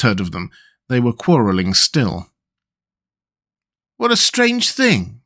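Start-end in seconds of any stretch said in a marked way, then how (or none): 4.0-5.2 much higher, slow wondering; puzzled overseer. A narrator's reading. Foundry overseer finding the unmelted heart, slow wonder. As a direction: puzzle over it aloud. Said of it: "said the overseer".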